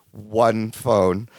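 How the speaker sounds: low, flat-toned incredulous echo; deadpan Larry. Retold or imitated. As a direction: say it dead low, unimpressed. Larry deadpan, much lower.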